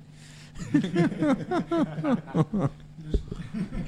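high burst of laughter; laughing. Real, not acted. Host laughs at his own joke.

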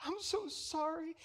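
high, soft, syrupy fake sympathy; mock a limp, pitying comforter. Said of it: "Jesus didn't go and say".

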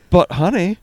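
high-pitched retold plea to wife; acted-out wheedling; mock pleading.

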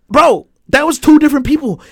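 high, loud disbelief; worked-up, high-pitched exclamation; astonished insistence. Real, not acted. Astonished at what the video showed.

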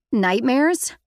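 loud, high, surprised; too loud. A narrator's reading. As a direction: blurt it out too loudly. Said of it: "I said it too loudly".

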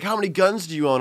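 high, eager mock question; imitating excited British kids. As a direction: ask it like an overexcited British kid. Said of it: "get so excited over hearing an American".

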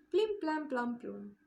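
high plucked notes falling away; ping each word like a string being tuned; vocal pizzicato, high start then dropping; imitated fiddle tuning. A narrator's reading. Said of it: "hangolta hát valamennyit".

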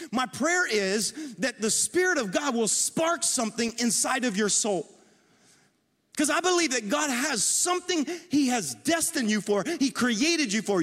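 high-pitched, driving sermon delivery; urgent pulpit encouragement; impassioned preaching. Acted. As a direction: preach it with rising fervour, pitched well above normal talk.

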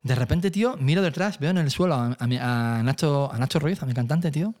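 normal talking voice

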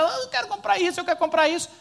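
put-on high whiny child voice; begging child. Father imitating his daughter begging at the newsstand, high whine.

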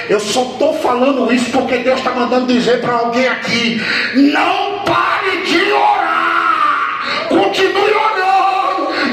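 loud, high, stretched preaching shout; full-force preaching. Acted. Pastor urging the church never to stop praying, full-force shouting. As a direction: drive every word into the congregation.

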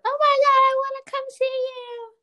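very high, loud excited squeal; squealing fangirl. Retold or imitated. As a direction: squeal it like a starstruck fan. Retelling her excited fan reaction.

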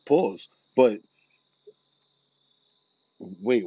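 ordinary talk

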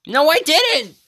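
high, loud denial; indignant shout. Real, not acted. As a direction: shout it back, offended. Playful denial shouted back.

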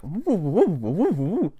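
high, wavering "woo" pulses; imitated speaker vibration. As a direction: mimic rattling plastic with wobbly hoots. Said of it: "you can hear the vibrations".

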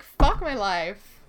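loud, slow despair; imitated exasperation. Imitating friend after name mix-ups, loud exasperation. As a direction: drag it out loudly, fed up. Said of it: "And you're like".

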